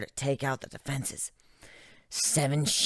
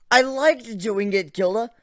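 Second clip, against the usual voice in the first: anguished confession; 7 semitones higher, 7 dB louder than usual. Acted. Griffin confessing the torture to Gilda, anguished. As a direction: force the confession out.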